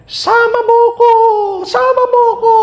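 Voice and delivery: high, loud grieving wail; wailing shout. Retold or imitated. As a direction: cry out like a mourner at the grave. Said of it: "may nakikita pa ako na sumisigaw".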